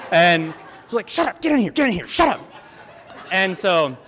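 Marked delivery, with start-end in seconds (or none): 1.1-2.4 high, hurried repeated order; urgent hushing. Retold or imitated. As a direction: hustle the little ones in. Hurrying younger brothers inside.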